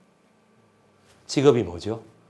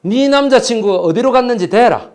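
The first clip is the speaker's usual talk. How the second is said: higher, louder mock interrogator; interrogator demand; 6 semitones higher, 4 dB louder than usual. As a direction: bark the demand like a torturer. Interrogator demands boyfriend's whereabouts.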